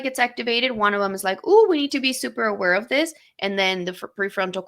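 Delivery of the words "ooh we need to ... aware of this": quick, higher, louder warning; mock alert. Brain circuit warning that we need to be aware, mock alert.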